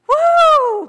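loud, high celebratory "Woo!"; real burst of church cheering; joyful whoop. Real, not acted. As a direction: cheer like the room just won. Cheering after God loves you.

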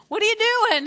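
high, loud, rushed question; mock incredulous challenge. Jesus confronting the wailing mourners, incredulous. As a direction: burst out at them in disbelief.

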